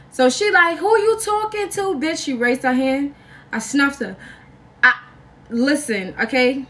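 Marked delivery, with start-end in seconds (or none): 0.8-2.2 very high-pitched challenge; mock confrontational girlfriend. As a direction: imitate her squaring up, voice way up. Girlfriend squaring up, retold.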